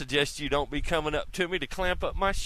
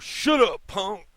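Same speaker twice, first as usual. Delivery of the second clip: loud, higher barked put-down; tough retort; 6 semitones higher, 5 dB louder, slower than usual. Retold or imitated. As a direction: snap it like a tough guy putting a punk in his place. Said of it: "And then Mister Gilroy goes".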